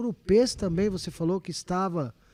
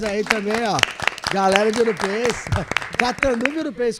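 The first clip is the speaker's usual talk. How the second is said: loud, high, cheering the crowd; rally the fans with a laugh; excited cheer; 4 semitones higher, 8 dB louder than usual. Real, not acted. Cheering the town audience.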